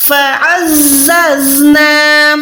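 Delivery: فَعَزَّزْنَا with ع from the throat, buzzing ز; فَعَزَّزْنَا drawn out; throaty ain. Shown as a demonstration. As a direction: say فَعَزَّزْنَا slowly, ز whistled. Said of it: "عین حلق کے درمیان سے".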